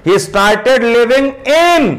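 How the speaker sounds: in punched with a falling tune; slow teaching read, in stressed. Shown as a demonstration. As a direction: hit the closing in hard. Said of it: "इन पर stress दे रहे हैं".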